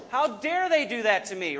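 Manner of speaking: loud, high-pitched indignant outburst; acted anger. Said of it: "you get angry, you get mad".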